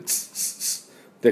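unvoiced ツッツッツッ like air from a punctured tube; tire-leak hiss imitation; hissing puncture sound. Shown as a demonstration. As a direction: hiss ツッツッツッ, slow and breathy. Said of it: "タイヤのチューブに針で穴を開ける".

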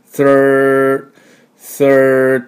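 "thir" held long on the r; very slow, stretched "thir"; drawn-out thir. Shown as a demonstration. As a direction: pull "thir" back into a long r. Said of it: "thirと引いてきます。".

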